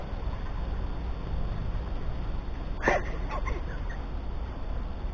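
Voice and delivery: a long, high, breathy wheeze of laughter; silent laugh. Retold or imitated. Showing how Karen laughed at the table, in a long breathy wheeze. And her mouth was like.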